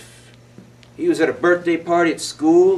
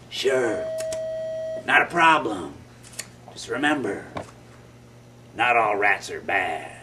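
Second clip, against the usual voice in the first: lower, soft rat voice; kindly rat; 8 semitones lower, 4 dB quieter than usual. Acted. Giant rat telling Willie not all rats are bad, low. Rat's parting words.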